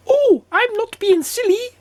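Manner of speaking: very high goblin protest. A narrator's reading. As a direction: answer back in a little squeaky voice. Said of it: "said the goblin".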